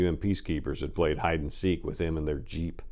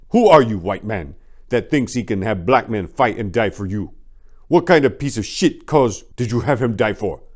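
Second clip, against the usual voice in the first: much louder, raging accusation; furious shouting; 7 semitones higher, 11 dB louder than usual. A narrator's reading. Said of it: "his anger on clear display".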